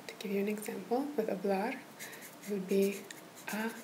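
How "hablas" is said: "hablas" with the stress on the last syllable, -BLAS; stress on the end. Shown as a demonstration. Tutor showing the student where the stress falls, stressing the end. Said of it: "put the emphasis on the last syllable".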